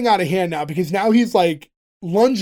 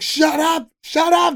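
screaming shut up; 9 semitones higher, slower than usual. Retold or imitated. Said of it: "he screams".

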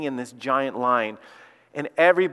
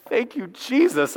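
relieved thanks; 8 semitones higher, slower than usual. Real, not acted. Relieved he didn't complain.

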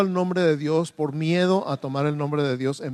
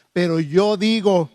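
loud, slow declaration of authority; 3 semitones higher, 7 dB louder than usual. Acted. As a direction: proclaim it as the one who has authority. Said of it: "les enseñaba como quien tiene autoridad". (con autoridad, fuerte y pausado)

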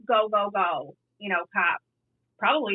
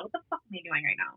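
low-key, much softer aside; muttered self-questioning; 3 semitones lower, 10 dB quieter, faster than usual. Retold or imitated. Officer stopping mid-chase to question herself, softly muttered. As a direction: drop the volume as if catching yourself. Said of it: "I literally said out loud".

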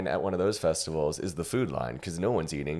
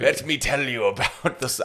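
slow, failed attempt at a German accent; bad German accent; 5 semitones higher than usual. Shown as a demonstration. Co-host clowning about the cashier, botched German accent. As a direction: try a German accent and miss. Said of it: "how to do a German accent".

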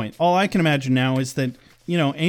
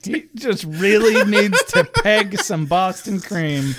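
laughing; 3 semitones higher, slower than usual. Real, not acted. Laughing joke.

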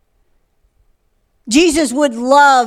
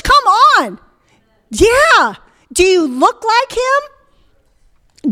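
raised-pitch, forceful sermon challenge; preaching; 5 semitones higher than usual. Real, not acted. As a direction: press the congregation with ringing questions.

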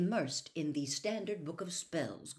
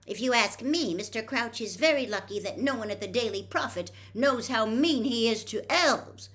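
put-on louder, higher angry girl; 7 semitones higher, 7 dB louder than usual. A narrator's reading. Hermione angry at Percy about the elves, loud. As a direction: let the indignation burst out. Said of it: "said Hermione angrily".

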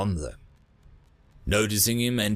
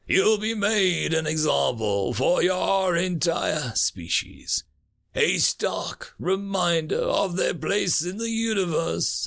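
gloating alien ruler; 6 semitones higher, slower than usual. Acted.

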